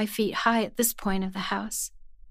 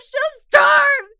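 starve shouted even higher and louder, breaking point; full shout; 9 dB louder than usual. Acted. The captive shouts at rescuers about her mother, full shout. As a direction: yell it out at the top of the voice. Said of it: "I kept droning on the same thing".

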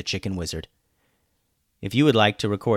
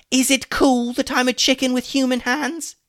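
high, loud indignant chicken; exasperated high-pitched sarcasm; sarcastic challenge; 7 dB louder than usual. A narrator's reading. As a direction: squawk the question incredulously. Hummus sarcastically challenges Alex.

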